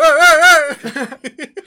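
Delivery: mock yelling. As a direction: yell at her from the back. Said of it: "le está dando gritos de fondo". (gritos de fondo a la señora)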